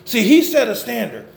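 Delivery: usual speaking voice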